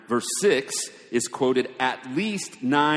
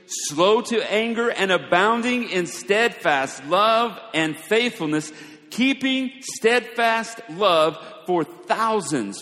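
ringing, louder and higher scripture reading; scripture proclamation; 5 semitones higher, 4 dB louder than usual. Acted. Preacher proclaiming God's name to the congregation, declaimed. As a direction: proclaim each phrase with weight.

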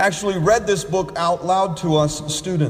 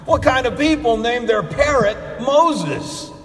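higher, louder, amused burglar; 6 semitones higher, 3 dB louder than usual. Acted. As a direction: scoff at the parrot's name. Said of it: "The burglar laughed".

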